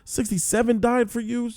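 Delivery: high-pitched exasperated telling-off; retold outburst. Viewer yelling at the screen, high-pitched exasperation. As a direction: chew him out as if he can hear. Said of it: "I said to my".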